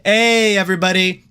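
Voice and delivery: ordinary talk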